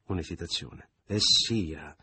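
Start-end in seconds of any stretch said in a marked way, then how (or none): 1.0-1.9 out of patience. A narrator's reading. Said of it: "come chi è al termine della sua pazienza".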